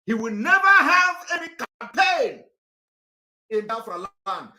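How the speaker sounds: angry shouted vow. Real, not acted. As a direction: shout it as a furious threat. Angry vow against a politician.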